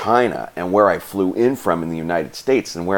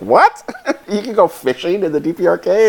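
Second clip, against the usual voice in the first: incredulous officer; 12 semitones higher, 4 dB louder than usual. Retold or imitated. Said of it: "he's like".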